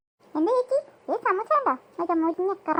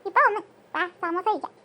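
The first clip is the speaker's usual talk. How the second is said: usual speaking voice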